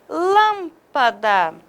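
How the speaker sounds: "lâmpada" said very slowly with a closed stressed â. Shown as a demonstration. Teacher slowly modeling "lâmpada" for the class. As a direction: draw out "lâmpada", leaning on the closed â. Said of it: "indica, além da vogal tônica, um timbre fechado".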